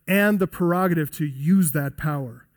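ordinary talk

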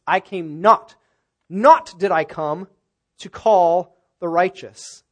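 "not" punched loud and repeated, phrase slowed; stress on NOT. Shown as a demonstration. Preacher showing where the original's emphasis falls, loud stress on "not". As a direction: hammer each "not", then spell out the rest slowly and loudly. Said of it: "which gives it the emphasis".

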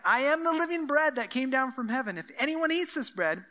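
ringing, insistent proclamation; emphatic. Acted.

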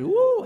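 high, loud "woo"; drawn-out excited whoop; admiring whoop. Real, not acted. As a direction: whoop it up over great preaching. Exclaims in praise of preaching.

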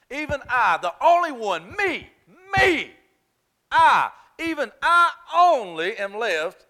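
"me, me, I" climbing high and loud, "even I only" drawn out; double emphasis. Retold or imitated. Elijah insisting he alone is left, emphatic and rising. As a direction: hammer each "me" and "I", slowing at the end. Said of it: "you see that double emphasis?".

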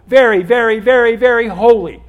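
"Very, very, very, very holy" loud and high, hammering each "very"; emphatic repetition. Shown as a demonstration. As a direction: punch every "very" up high and loud through to "holy". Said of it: "Emphasis is done by repetition".

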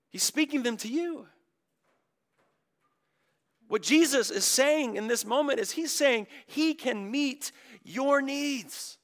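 ordinary delivery

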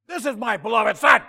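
mock-thunderous shout, pitched high and loud; majestic yelling. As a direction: bellow it like a preacher voicing God. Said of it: "they have the majestic glory yelling".

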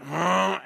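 loud high snort like a spooked horse. Retold or imitated. Preacher imitating the horse snorting again. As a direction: huff it out like a startled horse. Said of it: "and John went".

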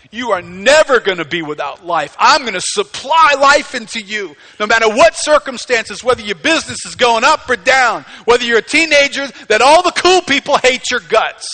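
loud, high, emphatic promise from the pulpit; full-force preaching. Acted. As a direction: proclaim the promise at full power.

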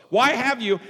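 loud, high-pitched accusing question; accusatory. Acted. Saul confronting the priest, accusing. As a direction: demand it as an accusation. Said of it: "in a simply accusatory way".

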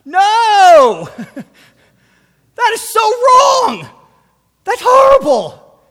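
high, loud outraged scream. Retold or imitated. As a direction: shout in shocked disbelief. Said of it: "he screamed out loud".

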